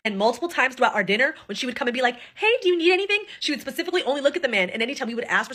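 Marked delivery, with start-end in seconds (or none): 2.4-3.2 high, bright fake-sweet waitress; higher mock server voice. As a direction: sweeten it for the men only. Waitress only asking the men.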